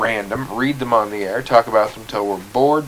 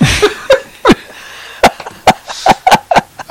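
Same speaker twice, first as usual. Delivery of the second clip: high laugh; 9 dB louder than usual. Real, not acted. Laughs after joke.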